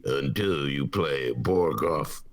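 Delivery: put-on low, slow Russian-accented chess player. Repeating a favorite show line all weekend, low Russian accent. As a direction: drag it out deep in a Russian accent. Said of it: "I was saying that all weekend".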